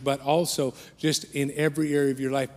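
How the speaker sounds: usual speaking voice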